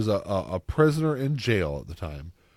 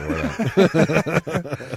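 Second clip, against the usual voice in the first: laughing; 7 semitones higher, 6 dB louder, much slower than usual. Real, not acted. Laughing off a silly remark.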